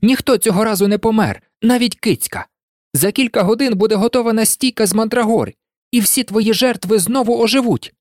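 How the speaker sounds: high, eager rush of victory; triumphant. A narrator's reading. Harry telling Riddle his victims will revive, triumphant. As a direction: rattle off the good news in defiant triumph. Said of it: "переможно вигукнув Гаррі".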